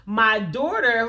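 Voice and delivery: ordinary talk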